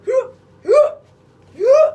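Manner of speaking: very high, loud hooting gasps; hammed-up imitation of panicked gasps; mock gasping hoots. Mimicking the grieving girl, very high mock gasps. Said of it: "So I'm saying".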